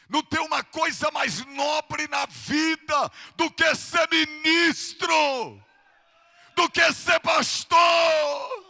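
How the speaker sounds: high, very loud, slow pulpit cry; thunderous revival-preacher declaration; full-force preaching. Real, not acted. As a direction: shout each phrase to the congregation, stretching the key words.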